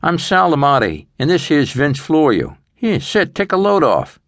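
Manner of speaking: high-pitched Brooklyn big guy; Brooklyn accent. A narrator's reading. Sal offering the newcomer a seat, Brooklyn accent. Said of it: "said with a Brooklyn accent".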